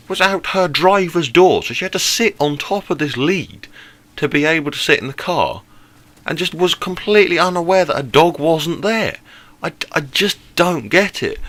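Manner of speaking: can't believe it, exasperated; incredulous. Real, not acted. Incredulous at the woman's stupidity.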